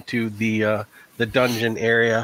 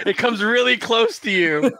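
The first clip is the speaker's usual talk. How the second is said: laughing; 10 semitones higher than usual. Real, not acted. Laughing at the roll.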